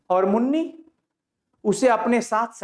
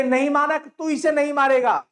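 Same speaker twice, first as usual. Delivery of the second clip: higher, urgent plea; pleading cry; 6 semitones higher, 3 dB louder than usual. Acted. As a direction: beg him once more. Bisni pleads again.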